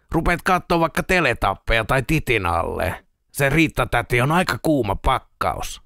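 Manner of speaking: lightly voiced teasing friend; sceptical friend. Friend dismissing a man's monster story as imagination, gently mocking. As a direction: talk him down with mild mockery.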